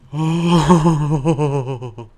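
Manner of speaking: long loud high exclamation; loud excited oh. Real, not acted. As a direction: let it ring out, thrilled. Game win.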